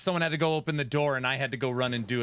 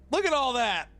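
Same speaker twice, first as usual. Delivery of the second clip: mock amazement; 11 semitones higher than usual. Mocking hair.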